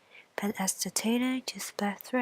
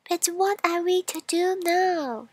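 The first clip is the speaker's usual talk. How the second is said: high, louder worried coal voice; high, louder anxious coal; coal character; 9 semitones higher, 6 dB louder than usual. Acted. Coal voiced in fairy tale.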